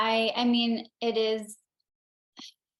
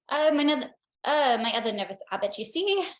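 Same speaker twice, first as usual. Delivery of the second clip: higher-pitched, put-on Cockney; Cockney accent; 4 semitones higher, 4 dB louder, faster than usual. Shown as a demonstration. Actress demonstrates her audition character for the interviewer, Cockney accent. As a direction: try an audition line in hammed-up Cockney. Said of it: "so I can hear your Cockney accent".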